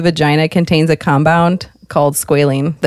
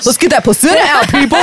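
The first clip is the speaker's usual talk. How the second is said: hype announcement; 8 semitones higher, faster than usual. Pretend. Acting a drunk midwife arriving.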